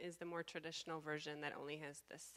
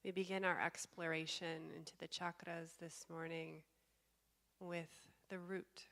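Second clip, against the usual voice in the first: slow, calm meditation guide with long pauses; guided meditation. Real, not acted. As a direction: guide them slowly into stillness, leaving space between phrases.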